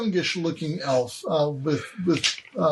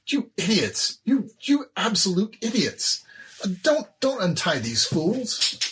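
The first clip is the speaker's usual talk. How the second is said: scornful elf; 6 semitones higher than usual. Acted. The elf scolds the hobgoblins for untying the prisoners, pitched high and contemptuous. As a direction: berate them as idiots, pitched high. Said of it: "the elf says".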